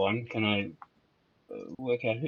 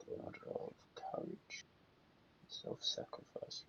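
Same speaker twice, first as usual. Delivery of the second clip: hushed whisper to self; 22 dB quieter, slower than usual. Real, not acted. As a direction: whisper it under your breath. Player whispers the god's title.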